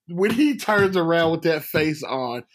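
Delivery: usual speaking voice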